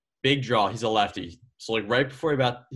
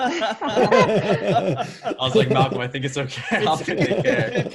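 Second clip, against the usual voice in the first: laughing; 9 semitones higher, 5 dB louder, slower than usual. Real, not acted. Laughing retelling friend's worry.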